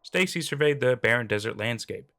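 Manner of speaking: plain reading voice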